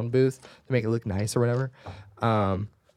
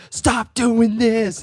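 much higher, louder and very slow; retold loud order; 10 semitones higher, 6 dB louder, much slower than usual. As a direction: lay it down slowly like a final warning. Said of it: "I said".

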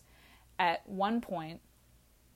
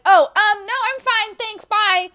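much louder, higher, rushed brush-off; panicked brush-off; 15 dB louder, slower than usual. Retold or imitated. Drunk Sam shooing away a helpful stranger, loud and high. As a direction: blurt it and bolt. Fleeing man offering help.

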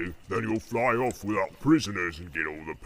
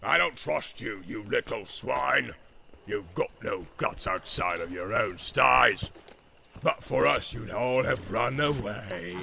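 contemptuous orc; 4 dB louder, slower than usual. A narrator's reading. Ugluk insults the orc.

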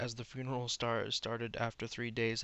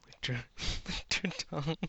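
higher, words squeezed out through giggles; helpless laughter; 5 semitones higher, faster than usual. Real, not acted. Reader embarrassed by fan fiction love scene, breaking into laughter. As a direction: try to read on while laughing. Reader cracks up at lurid line.

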